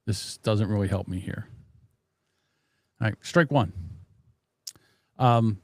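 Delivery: ordinary talk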